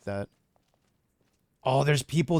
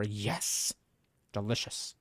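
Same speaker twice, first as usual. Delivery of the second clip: ordinary delivery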